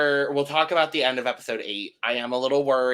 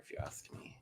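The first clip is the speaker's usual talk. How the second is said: breathy whispered afterthought; whispered aside; 25 dB quieter, faster than usual. Real, not acted. Host adding a sly aside to the audience, whispered.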